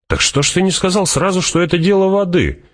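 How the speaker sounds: exclaiming. Acted. Fremen exclaims it is a water matter, high-pitched. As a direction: burst out high in sudden understanding.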